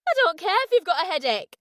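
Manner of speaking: put-on angry singer yelling at her boyfriend. A narrator's reading. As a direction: yell it across the room at him. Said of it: "Camilla yelled".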